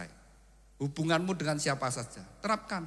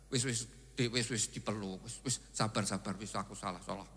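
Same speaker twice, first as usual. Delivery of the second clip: soothing husband; 4 semitones lower, 6 dB quieter than usual. Acted. Husband soothing his upset wife.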